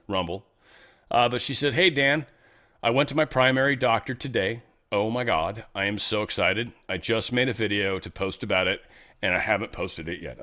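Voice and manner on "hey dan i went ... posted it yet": usual speaking voice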